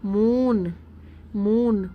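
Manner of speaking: मून with the oo held long; मून for pupils to hear the vowel; model word. Shown as a demonstration. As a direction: say मून alone, vowel drawn out. Teacher models word for vowel length.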